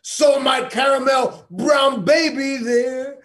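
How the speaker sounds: loud, impassioned performance poetry; shouted spoken word. Acted. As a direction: declaim it with grief and fury.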